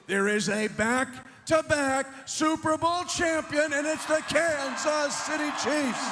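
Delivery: high, triumphant crowd call; excited announcement. Real, not acted. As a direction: proclaim the victory to fans.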